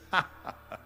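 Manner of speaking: imitated dismissive laugh; mock laugh. Hollywood agent hearing the bodybuilder wants movies, mock laughing. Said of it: "He started laughing".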